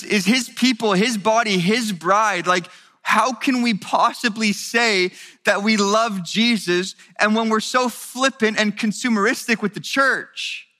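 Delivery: rising fervent sermon voice; impassioned preaching. Acted. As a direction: build the sermon to a passionate climax. Preaching builds to full force.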